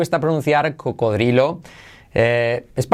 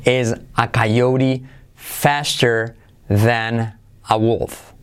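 very slow, lower, word by word; slow pronunciation model; 3 semitones lower, much slower than usual. Shown as a demonstration. Teacher modelling the demonstration sentence, slow and deliberate. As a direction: stretch out each word with gaps. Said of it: "Te pongo abajo la transcripción fonética".

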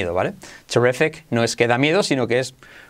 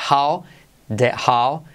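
"how did you" very slow, in pieces; desglose lento; much slower than usual. Shown as a demonstration. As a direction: break "how did you" apart for learners. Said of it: "de con Y se convierte en G J". ("how did you" muy lento, por partes)

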